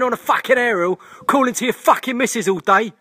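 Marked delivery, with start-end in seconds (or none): none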